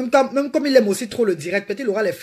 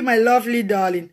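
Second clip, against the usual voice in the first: normal talking voice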